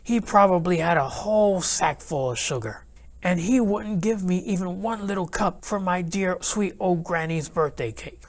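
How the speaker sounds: usual speaking voice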